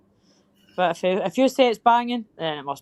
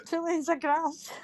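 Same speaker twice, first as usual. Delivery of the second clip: laughing; 8 semitones higher, 6 dB quieter, slower than usual. Real, not acted. Teasing through laughter.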